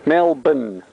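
Melbourne as MEL-bn, no R sounded; short Australian Melbourne, R dropped; Aussie Melbourne. Shown as a demonstration. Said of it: "M E L B N".

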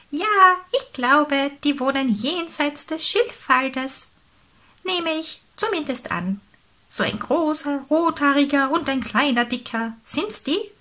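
animated storybook bird; helpful pelican, pondering. Acted. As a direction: think aloud as the pelican, ending on a high question. Reader voices the pelican.

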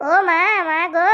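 slow, drawn-out endearing call to mother; sweet, affectionate call. Shown as a demonstration. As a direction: coax a mother-in-law with honeyed, stretched words. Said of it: "Madhushree ডাক দেবা". (মায়া জড়ানো মধুর সুরে ডাক)

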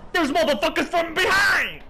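high shout of outrage; furious gamer yell, voice jumping up; raging. Real, not acted. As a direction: yell in real disbelief at being ambushed. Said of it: "I'm raging like".

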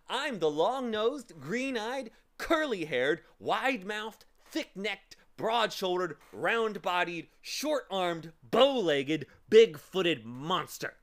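loud, slow, relished list; big, deliberate bragging, each item set apart; boastful monster. A narrator's reading. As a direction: proclaim each feature like a fearsome monster. Monster lists own frightening features.

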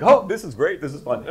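high, loud burst of glee; retold delight. Young actor to the tearful casting team, mock-gleeful delight. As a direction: imitate an oblivious, thrilled young actor.

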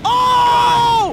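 loud, high shocked yell; shocked shout. Real, not acted. Gamer seeing someone thrown off a cliff, shouting. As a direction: cry out in horror.

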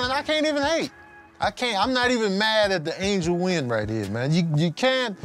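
usual speaking voice

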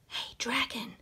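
soft breathy whisper. Acted. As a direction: whisper to test if he sleeps. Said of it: "Elizabeth whispered, very softly".

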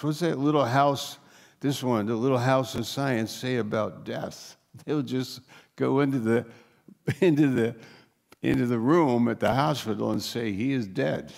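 usual speaking voice

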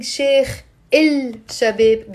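"Sheikh al-Shabab" slowly, with a full "ill" before Shabab; stretched "il" in "Sheikh al-Shabab", as the wrong version; unassimilated L. Shown as a demonstration. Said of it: "I'm not pronouncing".

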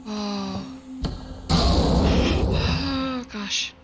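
sharp gasp then shaken oh gosh; startled gasp. Real, not acted. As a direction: jump at the scare. Sudden scare in game.

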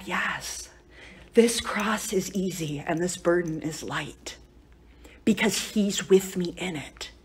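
soft, low retelling of a dying wife's answer; tearful retold reply. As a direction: answer the husband softly, slowly, with quiet faith. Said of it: "and she's crying".

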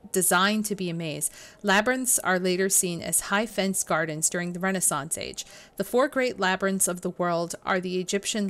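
steady reading-aloud voice. Acted. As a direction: read the book text out evenly. Reads in-game book text aloud.

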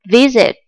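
"visit" stressed on the opening vi-; stress on first syllable. Shown as a demonstration. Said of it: "trọng âm rơi vào V". (visit, nhấn trọng âm vào âm tiết đầu "vi")

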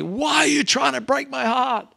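high mock scream of a heartbroken mum. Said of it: "my mum screaming out at me".

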